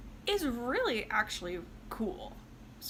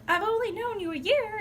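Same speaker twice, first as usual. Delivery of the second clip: defensive whine; 11 semitones higher, 5 dB louder than usual. Retold or imitated. Boyfriend answers girlfriend's complaint.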